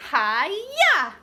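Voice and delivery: loud high hi-ya; karate shout. Acted. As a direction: chop the air with a yell.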